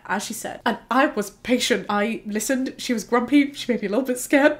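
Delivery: ordinary delivery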